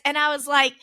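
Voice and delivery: usual speaking voice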